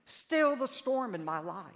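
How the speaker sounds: slow, high, loud plea for rescue; pleading cry. Acted. Believers crying out to God in a storm, slow loud plea. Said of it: "we cry out".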